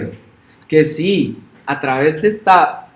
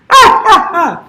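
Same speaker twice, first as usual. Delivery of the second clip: mock wailing cry, much higher and louder; hammed-up fake wail; 13 semitones higher, 7 dB louder, slower than usual. Preacher mocking a churchgoer faking tears, hammed-up wail. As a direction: ham up a showy sobbing wail.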